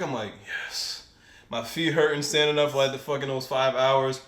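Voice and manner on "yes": hushed, voiceless answer; whispered yes. Retold or imitated. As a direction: whisper it back to the manager. Relieved answer to offered break.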